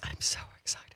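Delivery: hushed, breathy aside; whispered excitement; excited whisper. Real, not acted. Excited aside during studio introduction.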